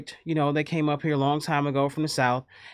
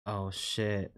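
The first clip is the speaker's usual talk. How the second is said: under the breath; 10 semitones lower, 12 dB quieter than usual. Retold or imitated. Seeing the ruined haircut.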